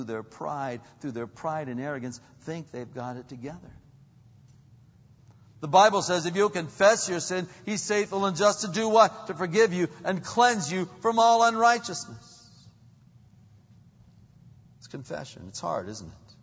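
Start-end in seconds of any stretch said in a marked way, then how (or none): 5.6-12.5 loud, high scripture proclamation; full-force preaching. Real, not acted. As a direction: drive the verse home with a raised voice.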